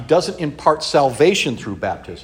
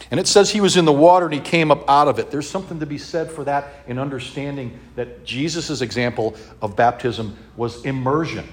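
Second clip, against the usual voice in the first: normal talking voice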